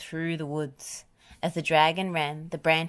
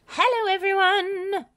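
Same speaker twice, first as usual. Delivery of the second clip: booming storybook holler, pitched up; roaring greeting; 5 dB louder than usual. A narrator's reading. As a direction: bellow a big greeting to a whole yard. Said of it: "roared a voice near the gate".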